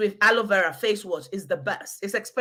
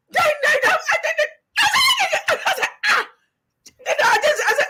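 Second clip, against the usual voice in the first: laughing; 4 dB louder, much faster than usual. Real, not acted. Breathless laughing retelling.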